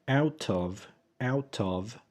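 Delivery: "Out of" with a clear released t; careful, unreduced "Out of"; careful full form. Shown as a demonstration. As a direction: sound both t and v in "Out of". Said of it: "لو عايزين نسرعها بنقول".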